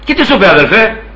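normal talking voice

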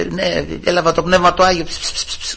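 hushed mumble trailing into whispers; soft murmur fading into psst-psst noises; mock whispering. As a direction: imitate timid, secretive whispering. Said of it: "να, να ψιθυρίζουνε".

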